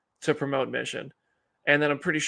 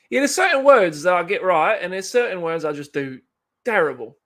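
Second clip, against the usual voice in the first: higher, louder fake British accent; put-on British accent; 4 semitones higher, 6 dB louder than usual. Streamer explaining which words come out right, mock British accent. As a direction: keep up the hammed-up British voice. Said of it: "approving of my British accent".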